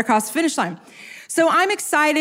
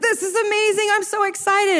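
retold giddy excitement, pitched higher; mock excited husband; 7 semitones higher than usual. Wife imitating husband thrilled at mile four, higher excited voice. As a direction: imitate him thrilled mid-race, voice up high. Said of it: "He loses his mind".

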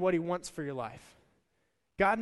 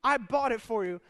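exasperated proof; 5 semitones higher, 5 dB louder than usual. Retold or imitated. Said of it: "He's like".

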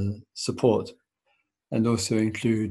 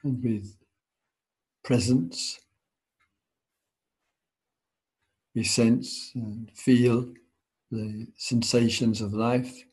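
guided meditation. Acted. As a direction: ease them into stillness, unhurried.

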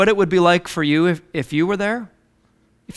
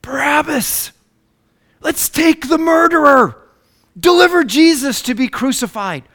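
high-pitched acted mob cry; crowd crying out; 6 semitones higher than usual. Preacher voices the crowd choosing Barabbas, high crying out. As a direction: call it out high, like a crowd demanding. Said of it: "And the crowd cries out".

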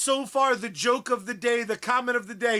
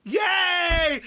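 cheering; 8 semitones higher than usual. Real, not acted. Celebrates win.

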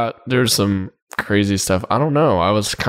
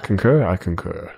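DiCaprio concur line; 4 semitones lower than usual. Retold or imitated. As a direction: quote the actor's concur line, lightly. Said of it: "that was my Leonardo DiCaprio, um, concur line".

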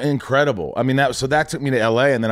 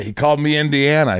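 ordinary talk

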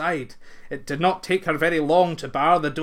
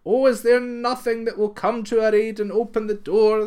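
higher, louder desperate cry; 6 semitones higher, 6 dB louder than usual. Acted. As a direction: plead out loud, tired and impatient. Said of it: "they cried".

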